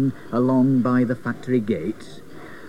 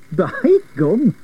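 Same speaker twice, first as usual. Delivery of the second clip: higher-pitched exclamation; excited cry; 6 semitones higher than usual. A narrator's reading. Cyril watching the runaway wheel, crying out excitedly. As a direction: exclaim it with gusto. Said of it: "Cyril cried".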